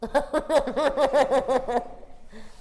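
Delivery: put-on high childish laugh; child's giggle. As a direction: giggle like a little boy. Boy laughs.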